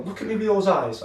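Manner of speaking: high, teasing look at me; lightly voiced flirty compliment. Presenter voiced flirting with him.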